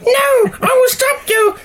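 squeaky high shout of a tiny hero; mock heroic outburst. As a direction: Leo as a guinea pig that roars, squeaking it out. Mocking mild Leo's outburst.

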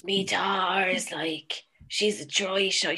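soft, put-on Dublin voice; Dublin accent. Parent pressing a drink on someone, Dublin accent. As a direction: coax quietly in mock Dublin. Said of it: "doing this in a dub accent".

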